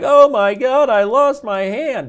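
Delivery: high, loud mock panic; hammed-up cry of horror, slowing at the end; wounded soldier. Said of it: "the guy's like".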